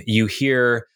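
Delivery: usual speaking voice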